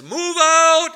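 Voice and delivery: loud, high echo of the command; repeated shout. Retold or imitated. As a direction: yell it back down the convoy. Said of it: "a guy three jeeps down says".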